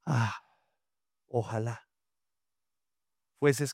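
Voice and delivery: sighing. Shown as a demonstration. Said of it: "Como si estuviera diciendo".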